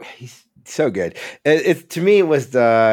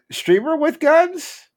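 incredulous; slower than usual. Real, not acted. Incredulous reading of the post.

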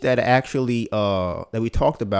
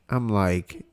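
usual speaking voice

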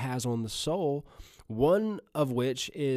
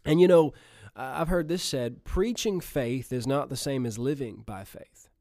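usual speaking voice

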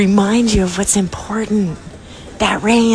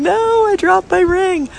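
high, slow retold wail of dismay; exclaiming in dismay; 12 semitones higher than usual. Girl in the stall wailing to her friend, high dismayed exclaim. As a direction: squeal it like a teen who lost her ring. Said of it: "she's in there exclaiming".

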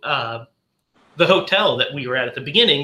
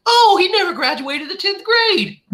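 much higher imitating voice, a bit louder; imitating the character; 12 semitones higher, 4 dB louder than usual. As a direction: mimic her whiny complaint. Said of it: "It's like".

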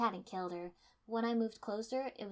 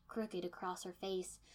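usual speaking voice

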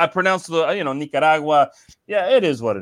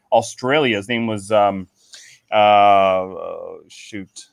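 ordinary delivery

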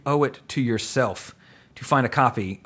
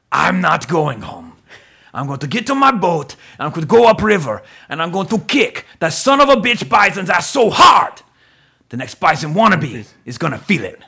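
rousing speech; 4 semitones higher, 6 dB louder than usual. Retold or imitated. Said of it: "he's like".

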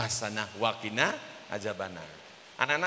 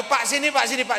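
quick, repeated summons, raised at first; retold calling out; 10 semitones higher, 7 dB louder than usual. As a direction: call someone over urgently, as a retold doctor. Doctor calling the man over. (menirukan dokter memanggil-manggil)

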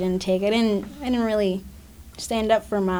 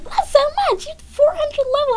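high teasing quote voice; high mock voice; 5 dB louder than usual. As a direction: squeak it out teasingly.

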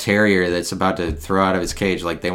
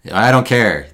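loud, higher mock shrug; dismissive; 4 semitones higher, 7 dB louder than usual. Voicing a Midwesterner who shrugs off outsiders, loud and dismissive. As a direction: toss it off with a careless sneer.

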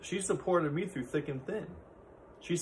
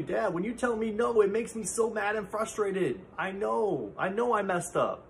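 put-on raised-pitch kid voice, higher; imitating his frustrated young son, voice pitched up; 6 semitones higher than usual. Son Dominic complaining to dad, higher kid voice. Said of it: "he tells me".

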